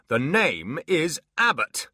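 loud, slow demon growl; slow furious demon. Acted. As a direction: spell out the name in rage. Demon insists.

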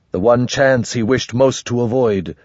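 plain reading voice

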